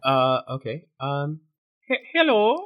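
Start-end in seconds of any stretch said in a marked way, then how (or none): 1.9-2.6 high, put-on Norwegian accent hello. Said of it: "And do it right now".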